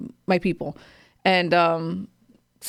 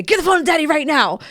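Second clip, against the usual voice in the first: put-on quick shouted command at a child; urgent loud order; 7 semitones higher, 5 dB louder than usual. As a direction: bark it out high and urgently, as if the call might drop. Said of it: "you have to hurry up and say".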